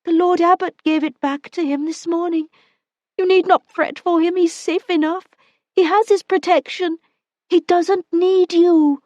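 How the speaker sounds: woman gives way under his grip, pleading; frightened woman. Acted. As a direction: plead with him, afraid. Woman defying him.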